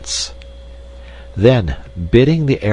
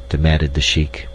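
ordinary delivery, nothing marked

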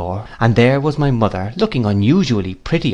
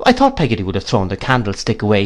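plain reading voice

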